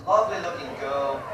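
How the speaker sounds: usual speaking voice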